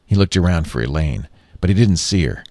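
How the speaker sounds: ordinary delivery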